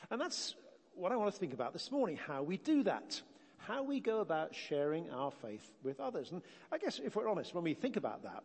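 ordinary talk, nothing marked

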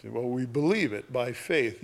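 usual speaking voice

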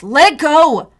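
furious demand; angry shout. Acted. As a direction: yell to be let go. Brother shouting to be freed.

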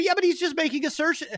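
high, mocking whiny objection; mocking skeptic voice. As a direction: mimic the skeptic's high scoff. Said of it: "Now you say".

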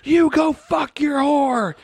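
high-pitched imitated furious yell; yelling wife. Imitating a wife yelling at her husband over escorts, furious shout. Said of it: "it was his wife yelling".

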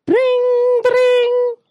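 a high, bright mock ring of the house phone; imitated phone ring. The phone rings as she tells the story, imitated high ring. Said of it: "The house phone goes".

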